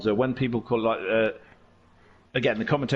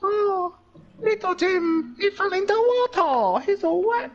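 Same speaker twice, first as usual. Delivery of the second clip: much higher, sing-song mock commentary voice; mocking lazy commentator. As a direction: parody a dull TV commentator in a high voice. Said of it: "all that lazy commentary".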